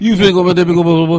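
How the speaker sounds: high, loud mocking gibberish; mock loud babble. Friend teasing about petting the buffalo, loud mock babble. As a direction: squeal nonsense at the buffalo like a fool.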